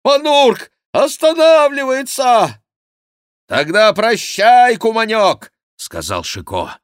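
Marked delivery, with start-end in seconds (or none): 0.0-2.6 high, loud, drawn-out alarm; monk's shout. A narrator's reading. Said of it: "кричал Гранфло". 3.5-5.5 high, loud, drawn-out goodbye; shouted farewell. A narrator's reading. Chicot calls farewell while galloping.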